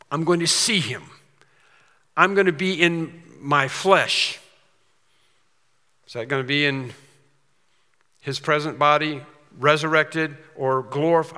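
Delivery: normal talking voice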